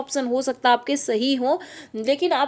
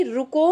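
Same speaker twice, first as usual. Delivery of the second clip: रुको set apart, rising at the end; intonation demo; slower than usual. Shown as a demonstration. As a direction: lift the voice on the last syllable of रुको, then stop. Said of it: "एक मैं कहूँगी". (रुको पर सुर उठाकर ठहरें)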